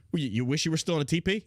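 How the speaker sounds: ordinary talk, nothing marked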